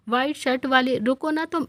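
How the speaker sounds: ordinary delivery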